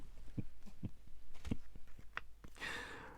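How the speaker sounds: long, soft wheezy laugh; breathy laughter. Real, not acted. Crafter keeps cutting bits off, breathy laughter. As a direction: laugh till the breath runs out.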